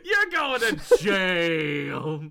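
very slow, loud mock accusation; mock verdict. Repeating the verdict to crack up the friend, mock verdict. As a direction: draw it out, exaggerated, on a laugh. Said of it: "Something about the way you said that".

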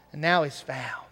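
soft unvoiced whisper. Father to older brother, hushed whisper. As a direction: breathe it out tenderly. Father's tender closing words.

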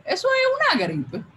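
very high-pitched, laughing shrug-off; breezy dismissal. Retold or imitated. Making cancer sound like a flu, lightly dismissive. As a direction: wave it away with a laugh. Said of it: "como que yo tengo gripe".

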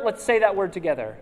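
ordinary talk

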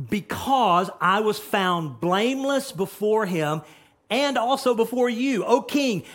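loud, high, slow preaching; fiery sermon peak; preaching at full force. Acted. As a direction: proclaim each word with fire. Preaching climax.

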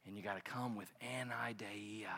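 hushed secret whisper. Acted. Preacher telling the crowd the secret, whispered. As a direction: lean in and whisper it.

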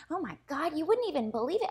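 high-pitched comic grandmother imitation; mock-amazed old storyteller, high voice; grandma telling a tale. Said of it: "She was making fun".

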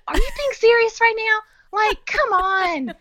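very high, incredulous retold protest; high-voiced mock exasperation; exasperated disbelief. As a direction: pitch it way up, drag out the end in disbelief. Retells exasperation at ordering rule.

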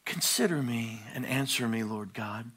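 imitating a sleepy coffee-time reading; soft, unbothered morning-coffee prayer; polite morning reading. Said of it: "with our little cup of coffee and we go".

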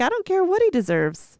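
Jesus cutting off the excuse, voice jumping up; Jesus, emphatic. Retold or imitated. Jesus answering the indignant wife, emphatically high. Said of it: "Jesus is like".

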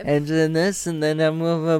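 ordinary delivery

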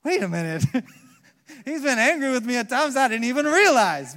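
loud, high-pitched comic outburst; mock alarmed realization. Younger man realizing his pastor was angry, mock alarm. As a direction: burst out as if it just dawned on you.

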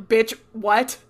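loud, incredulous retold double take; baffled outburst. Father and son look over at the fallen glass, baffled. Both startled by falling glass.

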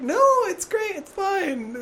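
a hint of a chirpy mother insisting; mother insisting it is fine, lightly voiced; imitating the mother. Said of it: "she's like".

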